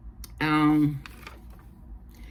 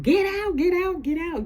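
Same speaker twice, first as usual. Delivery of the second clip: very high, frantic imitation; hammed-up panicked yelp to clear the place; mock freak-out; 4 dB louder than usual. Jordan freaking out at Claudette in the store, mock freak-out. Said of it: "Jordan freaks the fuck out".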